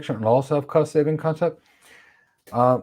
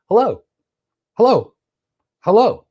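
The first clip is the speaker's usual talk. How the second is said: high, louder chirpy hellos from the protocol; mock router hello; 10 semitones higher, 5 dB louder than usual. Explaining OSPF to students, playful router hellos. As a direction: voice the router calling out to its neighbour, higher.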